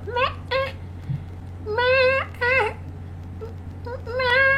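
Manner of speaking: high, drawn-out imitated meows; cat meows. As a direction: mimic her cat talking in syllables. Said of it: "she'll be go".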